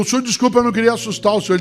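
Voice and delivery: high, fast flustered apology; apologetic passenger. Retold or imitated. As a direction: blurt out a quick sorry. Passenger apologizes to startled driver.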